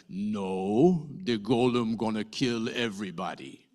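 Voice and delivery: a lightly put-on blunt golem voice. Golem telling Len and Miri his plan, lightly voiced. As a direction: speak as a simple-minded giant golem, lightly voiced.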